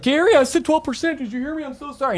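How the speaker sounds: squeaky apologetic phone talk; flustered high voice. Retold or imitated. Agent apologizing to Gary on the phone, high and flustered.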